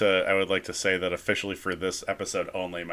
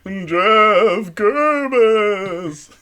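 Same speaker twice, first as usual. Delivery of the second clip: very slow drawn-out spooky announcer; low haunting voice; 12 semitones higher, 17 dB louder, much slower than usual. Acted. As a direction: stretch the name like a haunted host. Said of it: "announcer on S N L as our spooky voice".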